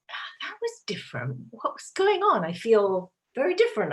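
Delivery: hushed realisation rising into a high, puzzled question; retold inner wonder, voice leaping up on the question; puzzled surprise. Acting out surprised thought after meeting.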